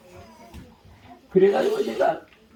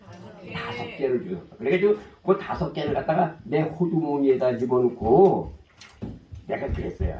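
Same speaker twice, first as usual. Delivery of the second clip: normal talking voice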